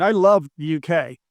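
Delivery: ordinary talk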